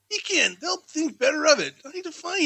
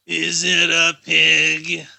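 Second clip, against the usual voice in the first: a lower, louder, slow guest's voice; low slow guessing guest; 9 semitones lower, 8 dB louder, much slower than usual. Acted. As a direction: guess like a gruff party guest.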